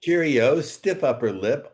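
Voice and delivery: a jokey stiff-upper-lip Englishman; mock posh English. Host teasing his guest in mock posh English. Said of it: "joining me from old Blighty, jolly old England".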